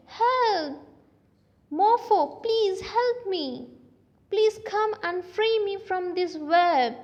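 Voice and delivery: high, pleading call for help; pleading cry for help. Acted. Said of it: "cried and said".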